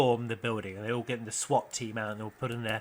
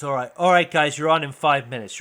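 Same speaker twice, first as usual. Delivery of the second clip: loud backstage call; stage manager call; 8 dB louder than usual. Acted. Stage manager calls to the band, loud. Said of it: "the stage manager's".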